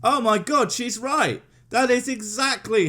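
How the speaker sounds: high sarcastic gasp; mock amazement.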